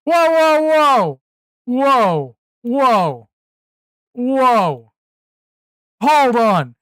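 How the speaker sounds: loud, high, startled whoas fading down; alarmed whoa. Real, not acted. Streamer spotting something in the clip, loud startled whoa. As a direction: stop everything in real alarm.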